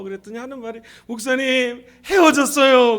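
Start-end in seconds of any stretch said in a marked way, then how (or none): 1.1-3.0 hammed-up wailing to the pastor; mock wail. Woman telling pastor of breakup, loud mock wail. Acts out tearful breakup confession.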